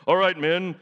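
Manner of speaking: loud rallying call to troops. Acted. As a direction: address the men like a commander. Gideon addressing his army.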